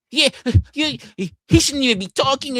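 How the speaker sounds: high, laughing mock chatter voice; high giggly mockery of chat trolls. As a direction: mimic the pile-on with a laugh. Mimicking chatters piling on.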